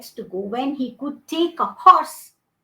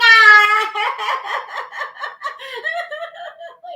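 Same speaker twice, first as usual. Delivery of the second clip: high, loud drawn-out wail; imitated child crying; 11 semitones higher, 8 dB louder than usual. A narrator's reading. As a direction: bawl like an inconsolable little boy. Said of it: "He went on crying".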